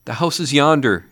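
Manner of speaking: excited Scrooge exclaiming. A narrator's reading. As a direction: blurt it out, pointing. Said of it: "Scrooge exclaimed".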